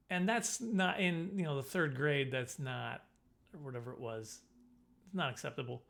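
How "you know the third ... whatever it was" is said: normal talking voice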